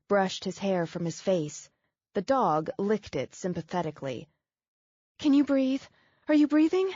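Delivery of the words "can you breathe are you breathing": high and louder, urgent worry; alarmed. Acted. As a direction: anxiously demand an answer. Checking on winded man.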